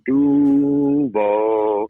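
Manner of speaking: "Duval" called out loud and high; hometown shout. Retold or imitated. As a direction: holler "Duval" like a hometown crowd chant. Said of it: "if you hear somebody be like".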